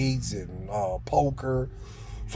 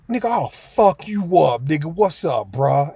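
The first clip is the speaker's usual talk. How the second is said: higher, louder threatening yell; retold threat; 4 semitones higher, 5 dB louder than usual. Narrator threatening Twin as he jumps up, higher louder yell. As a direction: square up and shout the threat.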